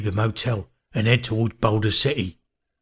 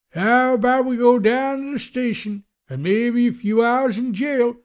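very high, drawn-out sheriff's warning; threatening sheriff; 14 semitones higher than usual. A narrator's reading. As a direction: threaten slowly in the put-on lawman voice. Sheriff threatens the girls with jail.

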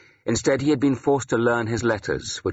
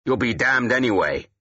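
higher-pitched, irritated comeback; a touch of snarling anger; snarled retort; 4 semitones higher than usual. Acted. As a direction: snarl back at him, moderately. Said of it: "Wulfhere snarled".

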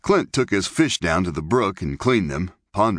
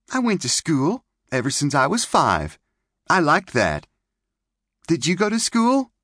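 put-on high, bright little-girl chatter; girlish May voice; 10 semitones higher than usual. Young girl May chattering to Clint by the camp. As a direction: play the pert pioneer girl.

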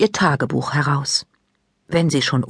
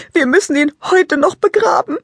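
put-on high, loud girl's voice, still in tears; tearful declaration; 11 semitones higher, 4 dB louder than usual. A narrator's reading. Lila tells Maike they must bury the rabbit today, tearful. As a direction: announce it firmly through tears. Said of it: "erklärt Lila".

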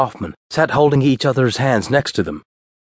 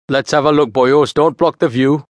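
put-on higher, lively Welsh accent; Welsh-accented interjection, pitched up; broad Welsh accent; 4 semitones higher, 3 dB louder than usual. A narrator's reading. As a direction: butt in with a broad Welsh lilt. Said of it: "interjected in his broad Welsh accent".

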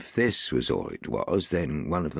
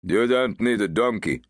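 helpful constable; 5 semitones higher, 5 dB louder than usual. A narrator's reading. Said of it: "said one of the constables helpfully".

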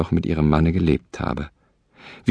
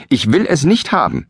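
much higher and louder, scolding; angry call; 8 semitones higher, 3 dB louder than usual. Acted. Wife scolds her husband at the door, loud and high. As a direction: shout him down from the room.

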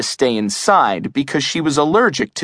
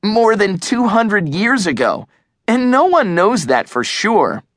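high-pitched, insistent rebuttal; argumentative correction; 8 semitones higher than usual. A narrator's reading. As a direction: argue the point back, pitch raised. Arguing back, correcting friend.

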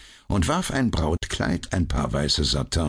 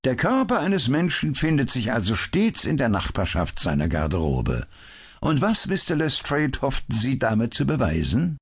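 a touch higher, amused and teasing; lightly voiced ironic detective; 8 semitones higher than usual. A narrator's reading. Holmes teasing the Scotland Yard detective, lightly ironic. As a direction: needle him politely.

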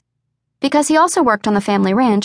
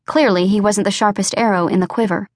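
usual speaking voice

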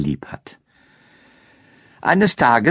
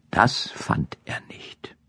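whisper; 8 dB quieter, slower than usual. A narrator's reading. Suspense ending of the tale.

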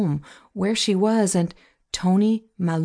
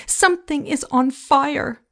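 scream; 7 semitones higher than usual. A narrator's reading. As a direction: cry out the alarm, voice pitched up. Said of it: "I screamed".

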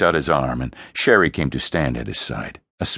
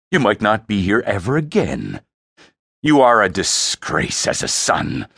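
scornful, deliberate dressing-down; dripping disgust; 5 semitones higher, 4 dB louder than usual. Acted. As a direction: berate your son with disgust, building in force. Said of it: "Frey's voice dripped disgust".

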